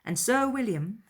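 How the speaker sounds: plain reading voice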